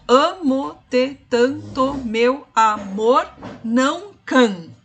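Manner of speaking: slow, syllable by syllable scansion; scanning syllables. Shown as a demonstration. Teacher scanning a sonnet line for students, drawn out syllable by syllable. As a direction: count out each poetic syllable, slowly. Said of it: "pra gente contar sílabas poéticas".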